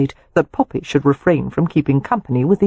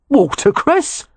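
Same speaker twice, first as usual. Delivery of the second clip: much higher, rising disbelief on Watercress; incredulous repeat; 10 semitones higher, slower than usual. Acted. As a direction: Watercress asked back in stunned disbelief. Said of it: "Jake repeated incredulously".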